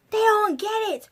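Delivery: ordinary talk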